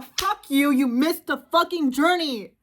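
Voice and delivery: angry rant. Real, not acted. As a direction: tear into them. Ranting at AI users.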